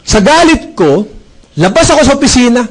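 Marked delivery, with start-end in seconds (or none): none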